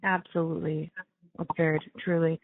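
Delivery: normal talking voice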